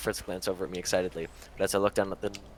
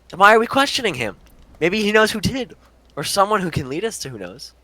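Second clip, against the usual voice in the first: urgent; 12 semitones higher, 10 dB louder than usual. Acted. Partner detective argues the point.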